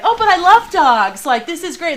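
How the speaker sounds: high, loud over-eager dog lover; loud mock gushing at a scared dog; gushing friend.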